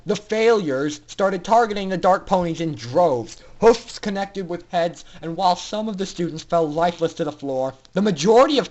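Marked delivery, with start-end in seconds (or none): none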